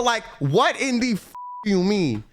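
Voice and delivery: ordinary talk, nothing marked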